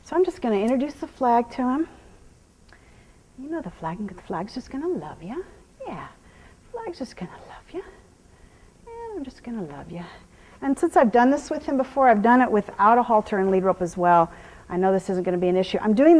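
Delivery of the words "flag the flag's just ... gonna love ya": breathy whispered reassurance; soothing whisper. Real, not acted. Trainer whispering to the horse about the flag. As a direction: whisper it gently to calm him.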